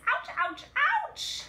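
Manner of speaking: rising yelps, last one shrieked very high; ow ow OW as the grater bites; cries of pain. Acted. Cheese Doodle grated.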